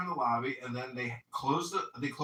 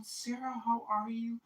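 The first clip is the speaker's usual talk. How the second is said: higher, softer sing-song fussing; mock concern; 8 semitones higher, 4 dB quieter than usual. Hotel group fussing over Sarah, higher mock concern. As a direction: gush over her, hamming up the worry. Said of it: "everybody's like".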